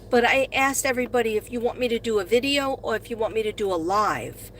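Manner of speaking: normal talking voice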